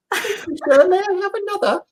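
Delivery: high, pleading orphan voice; Oliver Twist. Acted. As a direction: beg meekly like a starving orphan. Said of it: "remind me of Oliver Twist".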